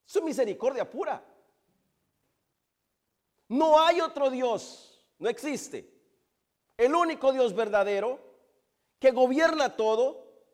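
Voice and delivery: high, loud, ringing declarations; emphatic pulpit proclamation; fervent preaching. Real, not acted. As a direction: proclaim each phrase with full conviction. Preaching at full force.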